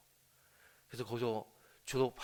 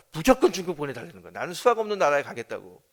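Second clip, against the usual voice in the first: much louder, pushing hard; insistent demand; 2 semitones higher, 12 dB louder than usual. Retold or imitated. Daughter loudly insisting her father send her to China. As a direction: demand it, no arguing.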